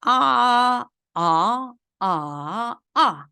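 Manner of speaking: 아 level, rising, dipping, then sharply falling; four-tone drill. Shown as a demonstration. Teacher letting students hear four tones on 아, level, rising, dipping, falling. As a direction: chant 아 through high level, rise, dip, drop. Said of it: "성조 사 성을 붙여서 한번 읽어볼게요".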